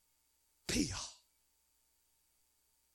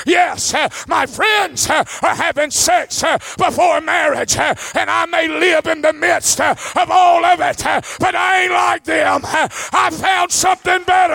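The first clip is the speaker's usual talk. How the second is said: preaching; 5 semitones higher than usual. Acted. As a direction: lean into each line till it peaks. Preaching build to climax.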